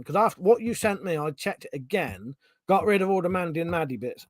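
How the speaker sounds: ordinary talk, nothing marked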